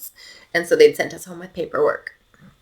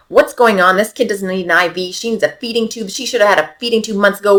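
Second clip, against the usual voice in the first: a touch of the ER doc's sharp demanding tone, louder and higher; mock brisk doctor; 5 semitones higher, 6 dB louder than usual. Mother imitating the ER doctor scolding. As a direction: imitate a brusque doctor firing questions. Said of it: "the E R doc that she was like".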